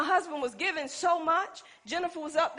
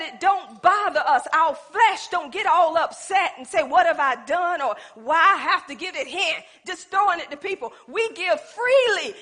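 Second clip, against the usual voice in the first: preaching at full force; 4 semitones higher, 8 dB louder than usual. Acted.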